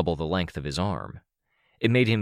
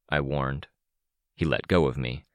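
ordinary delivery, nothing marked

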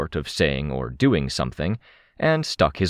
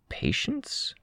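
"Patience" very high and much softer, rising; echoed question; 8 dB quieter than usual. A narrator's reading. Crystal echoes the puzzled question, "Patience" soft and high. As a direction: echo "Patience" in a small high voice.

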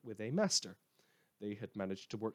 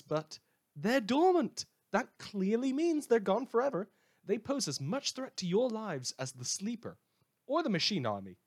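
high, loud, mocking sweetness; sarcastic reassurance; 11 semitones higher, 5 dB louder than usual. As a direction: pretend everything is fine, dripping with irony. Mock reassurance about dormant monsters.

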